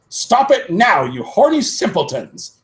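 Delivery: high, loud scolding shout; hammed-up teacher shouting at students. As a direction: scold the pair at the top of your voice. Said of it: "shouted Professor McGoggle".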